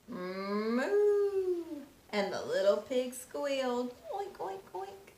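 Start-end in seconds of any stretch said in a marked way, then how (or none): 0.1-1.6 higher lowing moo; cow moo. Acted. Teacher mooing as the big cow at sundown, higher. 4.1-5.1 high soft squealing oinks; pig squeal. Acted. Teacher squealing as the little pig, high and soft.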